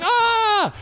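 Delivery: high startled shriek; mock panicked yelp. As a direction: yelp like a man about to be grabbed. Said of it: "And the guy's like".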